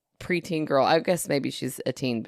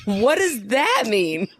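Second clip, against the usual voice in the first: slow, higher, mock-baffled question; baffled child; 5 semitones higher, 3 dB louder, much slower than usual. Nephew asks his aunt about a period, baffled. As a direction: imitate a kid drawing out his bewilderment.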